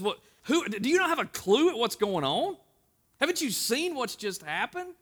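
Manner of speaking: a touch of sarcastic disbelief; incredulous Cleopas. Acted. Cleopas retorts to Jesus.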